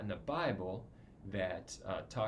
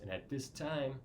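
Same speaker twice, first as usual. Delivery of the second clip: normal talking voice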